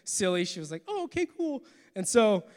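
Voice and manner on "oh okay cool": mock girlfriend voice. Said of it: "that was a bad representation".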